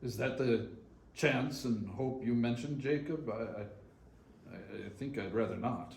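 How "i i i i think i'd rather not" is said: softer, hesitant, stammering; nervous stammer. Acted. Scrooge balks at the three spirits, a quiet nervous stammer. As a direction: shrink back from the idea.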